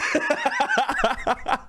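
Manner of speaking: burst of laughter. Real, not acted. Burst of laughter after punchline.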